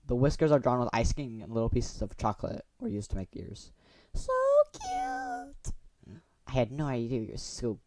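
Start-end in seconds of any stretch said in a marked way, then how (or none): 4.1-5.7 very high squealed "cute". Acted. Gushing over the cat cupcakes, squealed very high. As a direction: squeal it like it's adorable.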